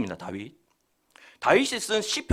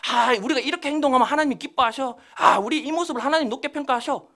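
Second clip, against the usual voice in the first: higher-pitched, self-assured put-on claim; mock know-it-all; 6 semitones higher than usual. Preacher imitating hypocritical religious leaders, mock know-it-all. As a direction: imitate someone pretending to be in the know. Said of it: "하나님 아는 것처럼".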